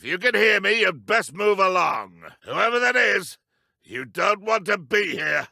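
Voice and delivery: calling out. Acted. Guard calls out to hidden intruder.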